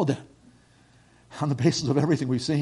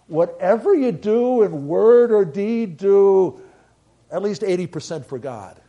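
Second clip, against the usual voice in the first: slow, louder, mock-solemn wrong version; mock misquote; 4 semitones higher, 8 dB louder, slower than usual. Shown as a demonstration. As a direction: drag it out as if it were scripture.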